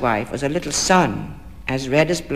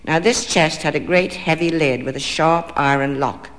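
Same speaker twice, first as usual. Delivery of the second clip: ordinary delivery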